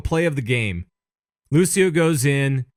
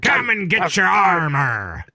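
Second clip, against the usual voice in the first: slow, higher, hammed-up pirate; 5 semitones higher, slower than usual. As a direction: bellow it like a pirate. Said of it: "it's just a pirate voice".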